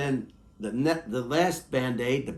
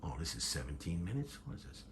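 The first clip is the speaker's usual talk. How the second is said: ordinary delivery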